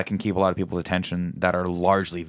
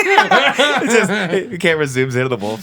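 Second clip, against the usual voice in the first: laughing; 11 semitones higher, 6 dB louder, slower than usual. Real, not acted. Laughing through the joke.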